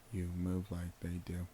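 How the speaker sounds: flat and dead, no feeling at all; emotionless monotone. Shown as a demonstration. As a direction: drain every bit of emotion. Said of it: "Who can say with the least amount of emotion".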